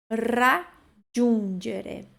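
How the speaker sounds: "Raggiungere" very slow, trilled ra, then djun-djere; syllable-by-syllable Italian. Shown as a demonstration. Teacher breaks down key syllables, very slow pronunciation. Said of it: "três sílabas importantes aqui".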